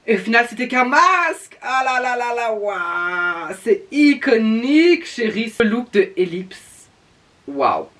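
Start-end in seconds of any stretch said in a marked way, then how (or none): 1.6-7.9 drag queen. Acted. Drag persona gushing over a look.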